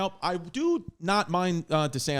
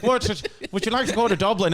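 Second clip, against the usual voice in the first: louder, higher mock Irish accent; 5 semitones higher, 4 dB louder than usual. Comic playing a patient who can't stop, mock Irish accent. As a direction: exaggerate the Irish brogue. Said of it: "developed an uncontrollable Irish accent".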